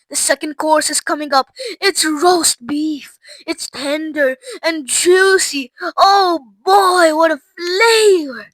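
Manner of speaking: high, slow, savoring delight; delighted girl. Acted. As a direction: relish each bite aloud. Violet raving about flavors.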